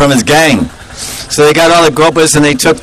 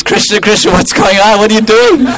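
higher puzzled calls; mock bewildered calling; 6 semitones higher than usual. Boys in the trees calling to Krishna, higher bewildered calls. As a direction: shout up confused from the trees.

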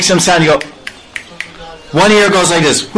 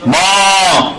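lowing like a mother cow; a playful cow call for her calf; cow mooing; 3 semitones higher, much slower than usual. Retold or imitated. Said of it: "the mother's going".

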